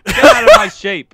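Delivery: high, loud comic yell; silly shout. Pretend. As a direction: yell it like a farmer. Yelled pun on sheep.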